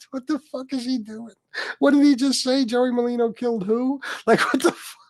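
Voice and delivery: high-pitched, baffled quoting of texters; incredulous mock reaction. Listeners texting him about a rambling guest, incredulous.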